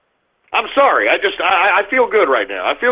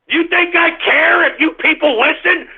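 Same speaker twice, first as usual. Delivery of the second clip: ordinary talk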